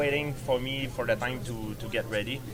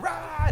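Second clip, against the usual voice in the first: high imitated roar of a wild locker room; a quick high rah, like the whole room going wild; retelling the chaos with a high yelp; mock roar. Pandemonium breaks out in locker room.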